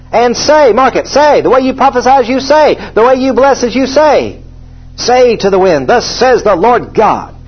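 high, ringing scripture proclamation; full-force preaching. Acted. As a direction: preach it out, drawing out the key words.

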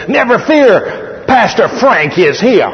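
high, slow, grand announcement; mock-heroic entrance line, drawn out; preacher mimicking his friend's showy arrival; booming Frank catchphrase. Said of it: "He's like that".

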